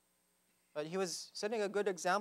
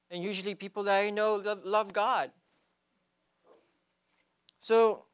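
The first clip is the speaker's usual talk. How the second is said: preaching; 4 semitones higher, 5 dB louder than usual. Real, not acted. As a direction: preach it with full conviction. Preaching at full force.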